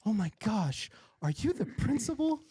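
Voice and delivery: whispered gasp rising into a high squeal; excited old classmate. Retold or imitated. Classmate spots him at parent conference, high surprised gush.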